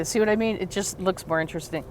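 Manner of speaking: ordinary talk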